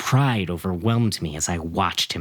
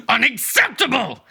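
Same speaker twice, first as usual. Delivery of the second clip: high, loud furious scream; enraged outburst; 14 semitones higher, 4 dB louder than usual. Acted. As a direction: scream it at the room. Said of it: "I screamed".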